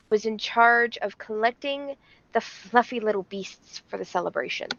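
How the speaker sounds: ordinary delivery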